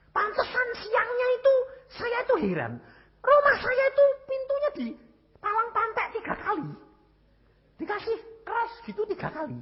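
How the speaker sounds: very high, loud, puzzled retelling; high-pitched bewilderment. Retold or imitated. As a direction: squeal out the bewilderment as you tell it. Recounts puzzling banging on his door. (heran dengan suara melengking tinggi)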